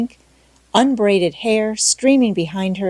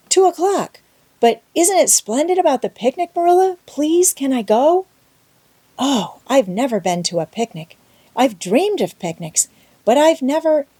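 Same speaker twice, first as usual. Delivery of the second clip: put-on high, bright pleading child's voice; eager pleading girl; 7 semitones higher, 4 dB louder than usual. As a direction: beg eagerly, tumbling over words.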